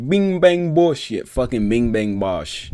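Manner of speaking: hammed-up English accent, bursting out on Bing; mock English accent. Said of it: "perfect English accent".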